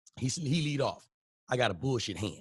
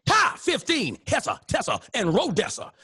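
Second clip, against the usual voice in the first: higher, laughing triumphant chant; gloating dad; 9 semitones higher, slower than usual. Retold or imitated. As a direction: crow over the points. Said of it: "daddy gloating slamming dominoes".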